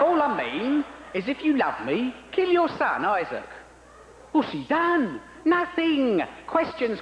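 high-pitched, breezy God. Acted. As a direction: make the murder request sound like a polite favour. God demands Abraham kill son.

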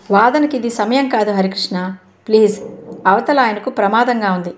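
ordinary delivery, nothing marked

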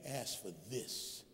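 very soft, low divine prompting; God speaking almost under the breath; hushed, deep instruction. Acted. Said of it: "He's saying".

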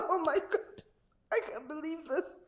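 ordinary talk

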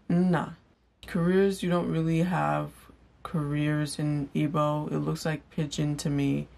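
ordinary talk, nothing marked

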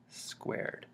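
conspiratorial hushed hint; teasing stage whisper. Pretend. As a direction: whisper the word like a secret. Teasing hint before villain laugh.

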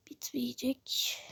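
breathy whispered worry to herself; barely voiced, just breath. Real, not acted. Muttering to herself mid-game, whispered. Fretting the watermelon runs out.

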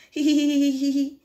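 high, evenly repeated hee-hee; mock giggle. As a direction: read out a staged little giggle. Reading a character's giggle.

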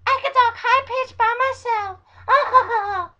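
very high, loud squeal, slowed down; high-pitched voice. Shown as a demonstration. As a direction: squeal it slowly at the top of your range. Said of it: "the right person for a high-pitched voice".

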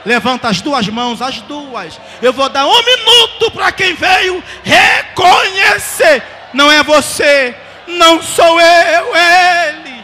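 shouted, high and slow pulpit cry; fiery Pentecostal preaching, drawn out; full-force preaching. Real, not acted. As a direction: preach it at the top of your lungs.

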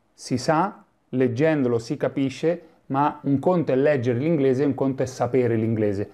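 normal talking voice

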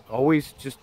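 usual speaking voice

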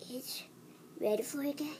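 ordinary talk

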